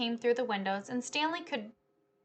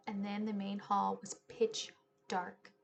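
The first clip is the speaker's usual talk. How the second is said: plain reading voice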